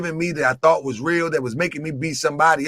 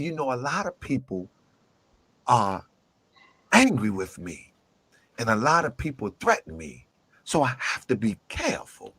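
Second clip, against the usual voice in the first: imitating soft talk; 7 semitones lower, 7 dB quieter than usual. Braswell Bey explaining why he is guarded: mock soft, low murmur. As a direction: speak softly and cautiously. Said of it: "they talk real soft".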